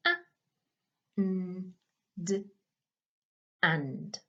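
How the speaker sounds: A, n, d, then blend and; sounding out words. Shown as a demonstration. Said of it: "it should look like this".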